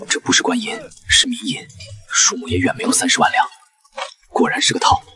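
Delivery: breathy unvoiced whisper. Whispering while sneaking in to inspect the silver. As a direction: whisper so no one hears.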